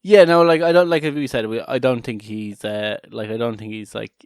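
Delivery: ordinary delivery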